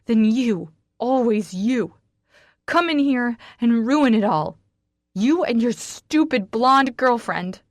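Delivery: rage, slowing on the last line; tearful furious shout. Acted. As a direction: blast him with blame. She shouts accusations at Raynor.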